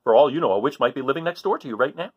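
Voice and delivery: put-on lively read-aloud to children; story reading. A narrator's reading. Teacher reading to kids, lively storytelling voice.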